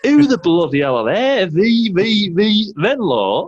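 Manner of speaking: high, loud Yorkshire outrage; Yorkshire accent. Retold or imitated. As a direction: bellow it like an indignant Yorkshireman. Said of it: "in his Yorkshire accent".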